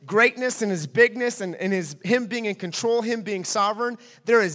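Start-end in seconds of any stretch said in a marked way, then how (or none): none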